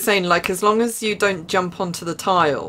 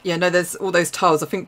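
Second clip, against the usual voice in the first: ordinary talk, nothing marked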